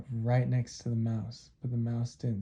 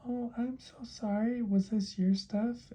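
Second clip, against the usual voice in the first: high, tiny mouse voice; squeaky-high creature voice, sorry; apologetic mouse; 11 semitones higher than usual. Acted. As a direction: apologise as a sheepish little mouse. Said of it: "mouse said".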